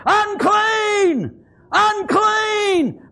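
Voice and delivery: very high, drawn-out cry; hollered warning. Acted. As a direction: holler it as lepers warning off passers-by. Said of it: "they're hollering".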